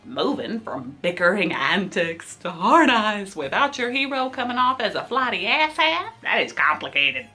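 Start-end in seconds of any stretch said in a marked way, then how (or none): none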